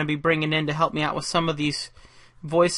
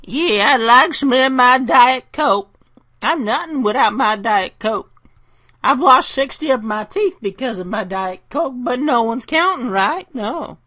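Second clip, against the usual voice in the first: silly put-on voice; 7 semitones higher, 5 dB louder than usual. Jokey bit about Diet Coke.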